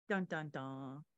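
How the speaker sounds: low sung dun dun dun, like an ominous movie cue; mock dramatic sting. Host teasing a plot twist to listeners, mock dramatic sting. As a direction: hum a spooky reveal sting.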